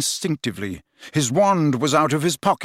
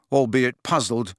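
plain reading voice

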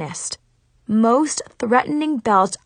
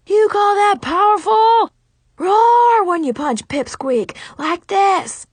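shouting karate teacher; 11 semitones higher, 5 dB louder, slower than usual. Acted. Sensei yelling at student.

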